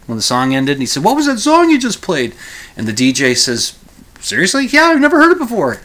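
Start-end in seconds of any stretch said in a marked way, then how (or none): 1.0-2.4 high, eager voice of the caller; mock excited caller on the phone. 4.2-5.8 high, fast, loud amazed DJ; mock astonished radio DJ; high excited DJ. DJ quoted, pitched high.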